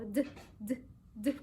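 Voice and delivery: short "d" taps, not the letter name; isolated letter sound. Shown as a demonstration. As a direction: say "d" alone, evenly spaced. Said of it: "los sonidos".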